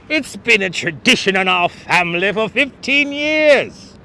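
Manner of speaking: light mock British accent. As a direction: play a snooty tenant with a British lilt. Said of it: "Did you like my British accent".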